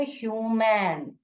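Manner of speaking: ह्यूमन slowly, with a stretched "yoo"; teacher's slow "hyooo-men" for ह्यूमन; long U sound. Shown as a demonstration. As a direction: draw out the U in ह्यूमन. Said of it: "long sound बोलता है".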